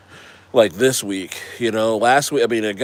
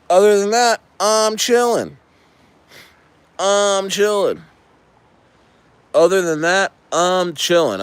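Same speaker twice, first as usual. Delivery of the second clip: relaxed; 7 semitones higher than usual. Real, not acted.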